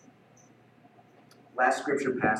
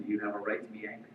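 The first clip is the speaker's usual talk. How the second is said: softer, voicing God gently asking; gentle quiet question; 7 semitones lower, 11 dB quieter, much faster than usual. God gently asks angry Jonah, soft and quiet. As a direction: ask it quietly, like a patient father.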